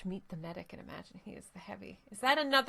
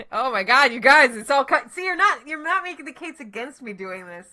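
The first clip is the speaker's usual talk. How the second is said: normal talking voice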